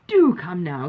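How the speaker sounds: loud, eager coaxing as Sir John; Sir John pleading. A narrator's reading. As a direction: press them warmly to come now. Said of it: "said he".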